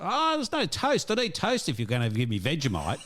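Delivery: high-pitched retold Aussie complaint; Aussie accent. Retelling her refusing Vegemite without toast, Aussie accent. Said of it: "just a normal Aussie accent".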